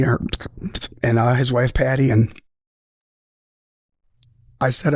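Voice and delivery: real choked-up emotion, long halting pauses; overcome, pausing to hold it together; getting emotional. Real, not acted. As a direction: fight back emotion, stopping between phrases. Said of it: "I do get emotional about it".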